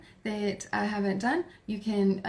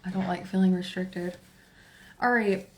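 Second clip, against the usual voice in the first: usual speaking voice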